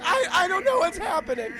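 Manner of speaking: high, loud bewildered whine; hammed-up helpless panic; panicked confusion. Cruise lost on the base, loud panicked whine.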